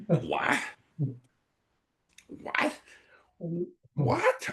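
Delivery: three baffled whats, the last leaping up high; stunned disbelief. Retold or imitated. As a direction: caught off guard, he can't believe what he heard. Shocked by the suggestion.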